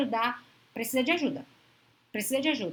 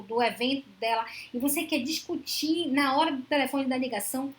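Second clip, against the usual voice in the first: ordinary talk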